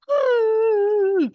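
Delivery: high, loud, drawn-out "ugh" of frustration; angry squeal. Retold or imitated. Woman recalling her anger at a pushy text, exasperated high cry. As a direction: let out one long exasperated high cry. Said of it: "I was so angry".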